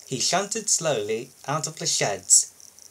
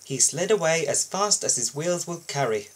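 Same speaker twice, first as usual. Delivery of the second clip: plain reading voice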